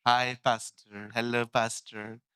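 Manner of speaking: slow, lazy hi and hello; mock casual greetings. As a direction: mimic careless greetings. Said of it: "हाय तुम पर". (लापरवाह अभिवादन की नकल)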